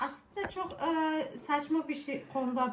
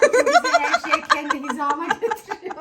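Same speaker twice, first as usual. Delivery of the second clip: laughing; 8 semitones higher, 10 dB louder than usual. Real, not acted. Laughing joke about short height.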